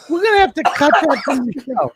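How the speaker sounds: real shocked laughter; laughing. Real, not acted. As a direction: laugh while saying it.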